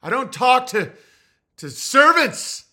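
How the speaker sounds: high, loud, slow imperious lord; mock medieval lord. To the clerk on the phone, a high loud imperious lord. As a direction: demand the master like an old nobleman. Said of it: "I'm like".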